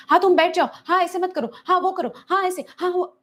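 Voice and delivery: high, fussy rapid-fire instructions; a nagging parent directing the child. Retold or imitated. Parent entering the therapy room fussing at the child, high and bossy. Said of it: "parent ना जैसे मेरे पास room में enter होते हैं".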